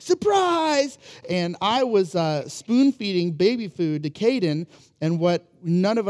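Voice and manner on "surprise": put-on high, loud yelled surprise in a child voice. Toddler at Red Lobster suddenly yells surprise, high and loud. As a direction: shout it out of nowhere like a toddler. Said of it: "everything's like".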